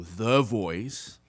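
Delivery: THE drawn out long before voice; emphatic, stretched THE; stress on THE. Shown as a demonstration. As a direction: lean slowly and heavily on the word "the". Stressing the missing article.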